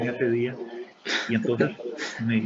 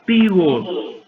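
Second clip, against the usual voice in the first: higher, louder crowd cry; retold crowd shout; 5 semitones higher, 9 dB louder than usual. Managers shouting back the company name, loud. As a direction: yell back like a cheering crowd. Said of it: "y gritaron".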